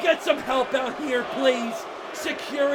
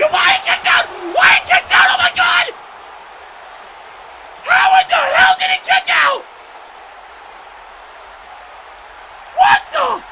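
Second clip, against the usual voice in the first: very high, loud disbelief; real shrieking amazement; astonished screaming; 7 dB louder than usual. Real, not acted. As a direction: scream as if witnessing the impossible. Commentator astonished by a kickout.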